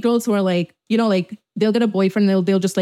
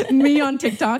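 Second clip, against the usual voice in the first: laughing through it, higher; 6 semitones higher than usual. Real, not acted. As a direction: giggle the words out. Laughing at her own joke.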